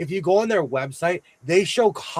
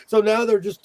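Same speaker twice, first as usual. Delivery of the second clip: normal talking voice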